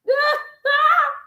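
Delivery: high, squealing burst of laughter; incredulous laugh. Real, not acted. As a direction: let out a high laugh of disbelief. After calling the writer idiot.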